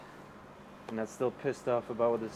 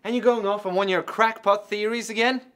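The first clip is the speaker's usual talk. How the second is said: raised, exasperated voice; angry; 9 semitones higher, 7 dB louder than usual. Acted. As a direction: snap at him in frustration. Argument over crackpot theories.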